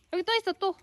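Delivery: high squeaky voice for a pet; squeaky praising a dog. Real, not acted. Praising a dog while feeding. (강아지 칭찬하는 높은 목소리)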